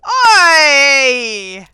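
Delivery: loud, high drawn-out "oi"; indignant shout. Real, not acted. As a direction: yell out in mock protest at the teasing. Mock protest at chat's teasing.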